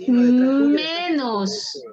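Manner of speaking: "Menos" stretched long and hit hard; emphatic prompting. Real, not acted. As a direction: lean on "Menos", drawing it out to cue the student. Teacher prompts student with key exception. ("Menos" recalcado y muy alargado)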